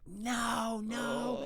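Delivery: high, drawn-out mock wail; imitated crying. Young daughter told the family is moving, imitated crying. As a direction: wail it like an upset little kid. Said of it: "She was crying".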